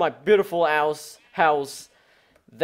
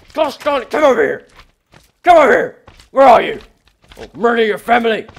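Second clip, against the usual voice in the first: higher and louder, hammed-up threats at a mob; mock rage yelling; 5 semitones higher, 10 dB louder, faster than usual. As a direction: bellow like a cartoon villain on the hunt. Chasing and killing a mob.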